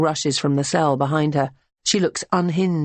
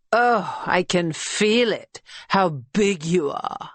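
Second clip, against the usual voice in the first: slow, lightly voiced seductive patter; flirting patter; 3 semitones higher, slower than usual. A narrator's reading. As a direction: tease the customer. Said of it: "her patter".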